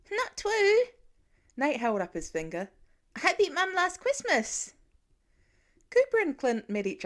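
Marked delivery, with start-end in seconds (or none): 0.1-0.9 put-on much higher, louder small child's protest; little boy voice. A narrator's reading. Small son correcting his dad, high and proud. 3.2-4.7 put-on high, bright child's boast; little boy voice. A narrator's reading.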